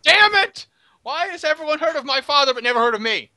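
high, hammed-up exasperation; petulant high-pitched complaint; indignant outburst. Character outraged at father's greater fame.

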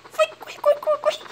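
high panicked calls to run; being chased. Real, not acted. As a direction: flee in a panic. Fleeing enemy in game.

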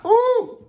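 high whoop as if burned; hot yelp. Acted. Yelping at the hot thing in his hand, high whoop. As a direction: yelp like the heat just hit.

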